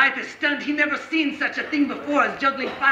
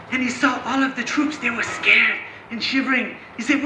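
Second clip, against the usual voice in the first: ordinary delivery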